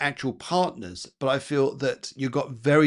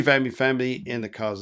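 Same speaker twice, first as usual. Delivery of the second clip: ordinary talk, nothing marked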